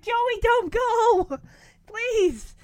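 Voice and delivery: high, loud, drawn-out begging; imitated little sister's urgent plea; mock pleading. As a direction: beg him to stay, hammed up.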